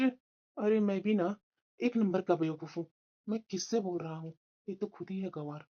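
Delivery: low, soft, slowing mutter; resigned, contemptuous aside; grumbling to himself. Acted. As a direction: grumble under your breath in self-reproach.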